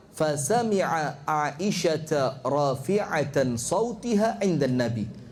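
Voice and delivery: slow, measured Arabic chant; hadith recitation. Acted. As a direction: recite the hadith slowly and solemnly. (melantunkan hadis dengan pelan)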